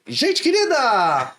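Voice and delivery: slow, drawn-out "Gente querida!" with "querida" falling; teacher catchphrase. Retold or imitated. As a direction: stretch out "querida" slowly to greet the class. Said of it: "só falava assim".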